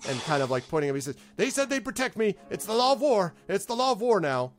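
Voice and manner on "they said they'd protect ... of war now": high, loud, flustered pleading; frightened stable hand hiding behind his protectors; scared stable hand. Acted. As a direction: cower behind the heroes and plead. Larg insists, scared.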